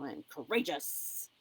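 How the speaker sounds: slow, squared-up self-affirmation; firm and ready for battle; proud and confident. Shown as a demonstration. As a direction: stand tall and own "I am courageous". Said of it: "stand yourself up tall and pull the shoulders back".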